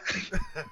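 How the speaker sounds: a high squeal of laughter; high burst of laughter. Real, not acted. Laughing at his own story, a high squeal of laughter.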